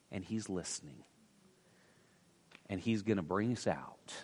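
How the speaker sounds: drops soft and low to comfort the church; soft reassurance. Real, not acted. Pastor softly reassuring the congregation, tender and low. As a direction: say it gently, like a promise.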